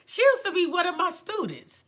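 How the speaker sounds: a hint of the instructor's bragging voice, up high; imitating the instructor. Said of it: "this is how she talks".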